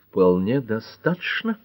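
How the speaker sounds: plain reading voice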